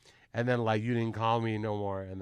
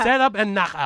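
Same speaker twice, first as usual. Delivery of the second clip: higher, louder Filipino melody; sing-song Filipino accent; 8 semitones higher, 8 dB louder than usual. Shown as a demonstration. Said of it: "sing everything like this".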